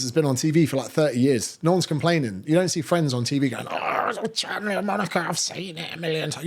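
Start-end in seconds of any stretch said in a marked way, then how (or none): 3.7-6.5 high, whiny mock moan; whiny complainer. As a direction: moan like a fed-up TV viewer, mockingly. Mimics a Friends viewer complaining.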